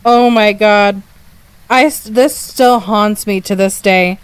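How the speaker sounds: ordinary talk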